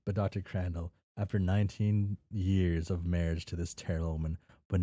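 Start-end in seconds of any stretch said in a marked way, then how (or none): none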